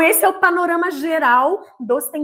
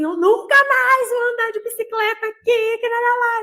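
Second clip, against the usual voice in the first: much higher, louder tearful protest; imitating crying daughter; 9 semitones higher, 4 dB louder than usual. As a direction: mimic a sulking child after a fall. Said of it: "chorou e falou assim".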